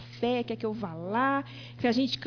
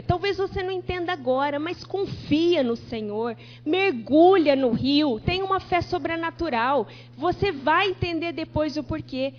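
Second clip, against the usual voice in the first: rising preacher fervor; 3 semitones higher, 4 dB louder than usual. Acted. Pastor urging the congregation to trust, in building fervor. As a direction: drive the faith home to the church.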